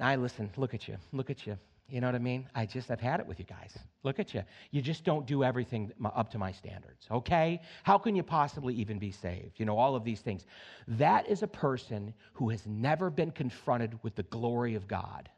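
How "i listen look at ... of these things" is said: lower, softer scornful sneer; mock the self-righteous judge.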